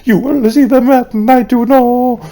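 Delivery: high mock voice. Gamer reading the note in a high mocking voice. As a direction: read it like a giddy creepy child.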